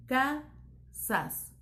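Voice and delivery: "casas" split as ca, pause, sas; dividido en sílabas. Shown as a demonstration. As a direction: say "casas" in two separate syllables, ca - sas. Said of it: "¿Se divide aparte?". ("casas" separado en ca - sas)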